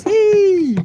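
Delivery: loud, high burst of delight; a real, happy yelp; excited shout. Real, not acted. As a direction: blurt it out with a sudden thrill. Sudden excited outburst mid-talk.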